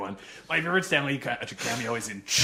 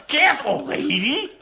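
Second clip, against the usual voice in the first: high, loud comic protest; mock scolding yell; 12 semitones higher, 6 dB louder than usual. Bitten apple to Eve, high mock scolding yell. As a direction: call it out in hammed-up hurt.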